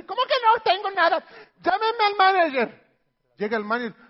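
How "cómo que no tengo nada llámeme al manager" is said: mucho más aguda y fuerte, indignada; clienta indignada. Acted. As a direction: exige con indignación al cajero. (clienta indignada exigiendo al gerente)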